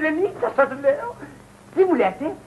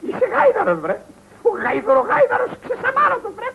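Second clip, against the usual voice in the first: high-pitched scolding outburst; angry insult; 7 semitones higher than usual. Acted. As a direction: fling the insults out in indignation. Insulting a relative in a quarrel. (θυμωμένο βρίσιμο)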